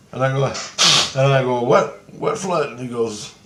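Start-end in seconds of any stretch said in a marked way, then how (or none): 1.6-2.7 high, slow baffled question; re-enacted bewilderment; retold puzzled surprise. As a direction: act out being caught off guard. Retells own puzzled question to Christ.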